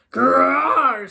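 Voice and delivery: loud, high mock growl; a young lion's loud put-on growl. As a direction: growl at him like a cub lion. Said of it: "said the lion".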